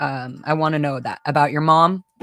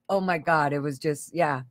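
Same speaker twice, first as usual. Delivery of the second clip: usual speaking voice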